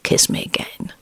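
whispered, unvoiced plea; muttered. A narrator's reading. Husband whispering to his wife in bed. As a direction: breathe it without voice. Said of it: "he muttered".

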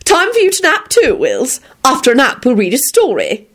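high, singsong cheerful lady; cheery grandmother. A narrator's reading. Lady Fanshawe sending little William to nap, cheery and high. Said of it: "Lady Fanshawe announced cheerily".

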